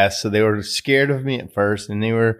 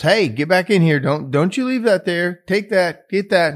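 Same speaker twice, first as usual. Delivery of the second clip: higher-pitched, insistent parental orders; scolding father; 9 semitones higher than usual. Retold or imitated. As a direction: imitate a dad calling his child back. Father orders boy back.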